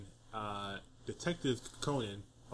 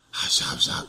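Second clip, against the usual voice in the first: loud voiceless rasped hushing; failed childhood whisper; 9 dB louder, slower than usual. Retold or imitated. Man imitating himself as a kid, loud voiceless whisper. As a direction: blast out breathy nonsense as a mock whisper. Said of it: "like, a whisper".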